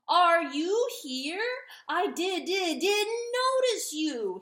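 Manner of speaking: loud, high, slow stammering shout; shouting turkey. A narrator's reading. Turkey shouting at the dog beneath him. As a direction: bellow it with fake surprise. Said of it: "he shouted".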